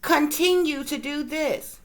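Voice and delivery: drawn-out, pointed mock line; slow emphatic quote. Vlogger mimicking a troll's slow pointed hint. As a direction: spell each word out slowly, loaded. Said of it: "they'll put an emphasis on certain words".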